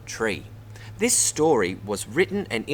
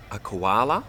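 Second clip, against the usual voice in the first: ordinary delivery, nothing marked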